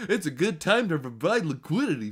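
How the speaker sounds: laughing through a lightly voiced whale; half-laughing send-up of a smug investor; mock whale. Streamer mocks the whale's post, laughing through a lightly put-on voice. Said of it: "And then what is he saying?".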